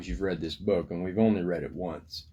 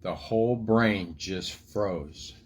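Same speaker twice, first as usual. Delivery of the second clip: lively picture-book storytelling voice; reading to children. A narrator's reading. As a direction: tell the story to little kids.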